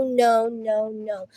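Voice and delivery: usual speaking voice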